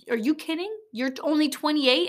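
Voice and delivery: lightly voiced motivational speaker; mock coach disbelief; imitating Gary Vee. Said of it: "He was like".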